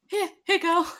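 high, coaxing call to a pet; calling a dog. Retold or imitated. Player mockingly coaxing the orc child like a dog, high. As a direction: coax her like a puppy. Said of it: "Be like".

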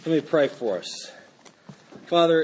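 ordinary delivery

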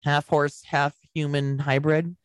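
half human hybrid, slow and spelled out; repeating it slowly. Shown as a demonstration. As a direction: lay out each word of "half human hybrid" slowly for effect. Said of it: "repeat what you just said".

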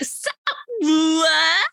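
high squeaky incredulous so what. Retold or imitated. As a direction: ask it in shocked disbelief. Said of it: "I was like".

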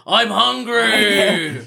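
slow, high drawn-out monster wail. Pretend. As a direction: groan it like a starving beast. Air vent screaming out.

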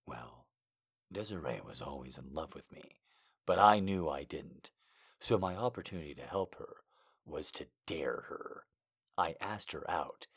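put-on low, soft storytelling boyfriend; hushed lover voice. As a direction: confide his past quietly. Male character continues story.

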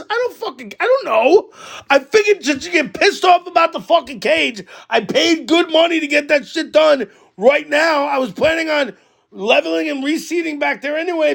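high, exasperated retelling of an argument; heated self-defence. Retold or imitated. Retold quarrel, defending himself to neighbor.

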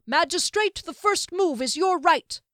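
high, loud proclamation; shouting. A narrator's reading. Man on the platform invites the magistrate to begin, shouting. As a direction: call out ceremoniously over a crowd. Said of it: "the man shouted again".

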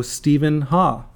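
ordinary talk, nothing marked

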